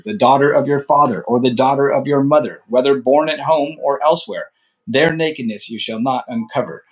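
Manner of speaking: measured, formal Bible reading voice; scripture reading. Acted. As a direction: read the law as holy text, steady and solemn. Resumes reading after comment.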